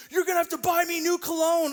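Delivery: put-on teen son yelling. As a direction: play the outraged sixteen-year-old. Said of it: "He's like".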